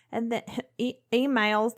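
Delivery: emails said with a southern twang; southern accent. Real, not acted. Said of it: "Did you hear me? Where'd that come from?".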